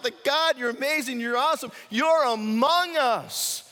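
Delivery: high, loud jubilant praise; cheering praise. Retold or imitated. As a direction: cheer to God like a crowd. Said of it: "everybody's cheering on God".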